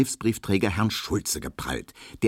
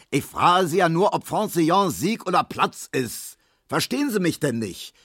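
höher und lauter, ungeduldig; ungeduldiger Schrei; 11 semitones higher, 3 dB louder than usual. A narrator's reading. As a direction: fahr ihn gereizt an. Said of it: "schrie der". (Markowski fährt den stummen Barbier an)

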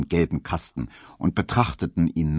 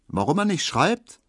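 maid, decisive; 11 semitones higher than usual. A narrator's reading. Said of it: "in einem Ton".

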